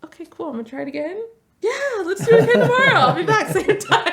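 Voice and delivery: put-on a kid quoted, thrilled to come back tomorrow; eager excitement. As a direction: bubble over with newfound enthusiasm. Client quoted, newly eager.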